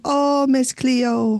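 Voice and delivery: slow, drawn-out Jamaican accent. Shown as a demonstration. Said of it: "That's all I can do of a Jamaican accent".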